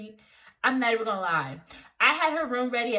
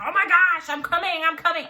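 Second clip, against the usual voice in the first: higher, louder frantic mom; mom screaming; 5 semitones higher, 5 dB louder, faster than usual. Retold or imitated. Mom on the phone, screaming that she's coming. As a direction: imitate a mother yelling in panic. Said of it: "She's screaming".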